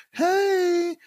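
high bubbly saleslady voice; gushing booth lady. Retold or imitated. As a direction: gush like an eager vendor. Mimicking expo booth lady.

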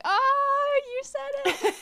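very high, drawn-out "Oh"; mock gleeful outcry. Imitating international friends catching the word wicked, high gleeful outcry. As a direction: squeal it like teasing friends who caught you. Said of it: "And they were like".

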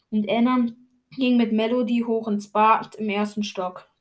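ordinary delivery, nothing marked

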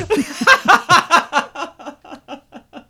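loud high cackle trailing away; real helpless laughter; big laugh. Real, not acted. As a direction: burst out laughing and run out of breath. Laughing at own innuendo.

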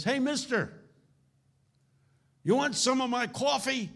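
high voice of a rough street man. Retold or imitated. Homeless man offers the preacher his coffee, voiced high. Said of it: "and he says".

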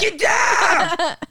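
high, loud pretend alarm scream; mock screaming. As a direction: scream the warning, hammed up. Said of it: "What if she just started screaming".

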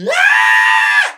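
piercing high shouted scream; pterodactyl shriek. Retold or imitated. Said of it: "good pterodactyl scream".

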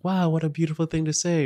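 high-pitched, wowed reaction; mock admiration. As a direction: gush like an impressed listener. Acting out an admiring listener's reaction.

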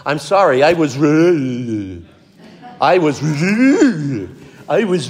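choking on the word, stretching it into a garbled stammer; drawn-out, comically stuck apology; Fonzie stuck apologizing. Acted. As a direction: try to admit fault but jam on the last word. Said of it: "he could not say".

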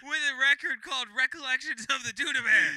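loud, high, laughing disbelief; incredulous. Real, not acted. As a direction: exclaim it in disbelief.

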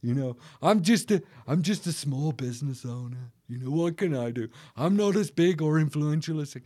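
lightly put-on self-belittling voice; Eeyore. Small business owner making excuses, mild mock Eeyore voice. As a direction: imitate people making meek excuses. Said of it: "the Eeyore spirit".

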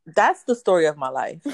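usual speaking voice

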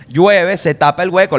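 normal talking voice

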